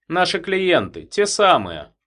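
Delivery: confident. A narrator's reading. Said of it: "убеждённо сказал".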